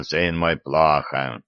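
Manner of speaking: "плохо" dropped low and fading; sad old priest. Acted. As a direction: sigh out "плохо" low and sorrowful. Patriarch lamenting, low voice.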